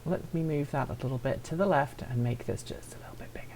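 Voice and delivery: half-whispered to himself while fiddling with the layout; breathy aside under the breath. Real, not acted. Muttered aside while adjusting stream.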